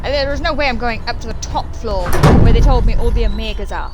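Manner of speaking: loud, high frantic rant; panicked outburst. Real, not acted. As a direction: blurt it out in a panic. Panicked after chase.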